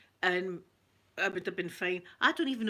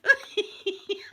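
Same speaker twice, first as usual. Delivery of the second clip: high, loud squeal of giggles; real giggle; 11 semitones higher, 5 dB louder than usual. Real, not acted. Admitting it was terrible, high giggling. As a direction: giggle at your own bad behaviour.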